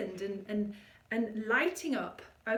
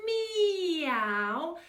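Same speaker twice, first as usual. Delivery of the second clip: lightly higher sung meow; kitten meow; 4 semitones higher than usual. Shown as a demonstration. Coach meowing into the nose. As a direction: meow into the whiskers. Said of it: "So we have whiskers".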